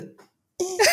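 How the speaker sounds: high squeezed mock grunt; strained comic turtle noise; turtle grunt imitation. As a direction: squeak like the humping turtle. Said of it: "it may have been a".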